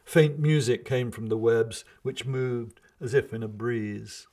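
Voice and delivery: usual speaking voice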